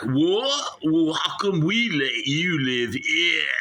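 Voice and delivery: high, loud, outraged mock Aussie; Australian accent. Host protests to snake handler, loud high Australian accent. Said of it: "You're bloody talking like me".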